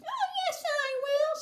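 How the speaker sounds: put-on very high miniature boy voice; tiny squeak. A narrator's reading. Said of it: "squeaked the tiny voice".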